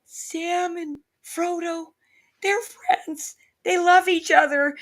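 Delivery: put-on very high, very slow child's voice, welling up; imitating her daughter. Mom voicing her daughter crying over Sam and Frodo, high and slow. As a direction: stretch it out like a small kid overcome with feeling. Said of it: "And she's like".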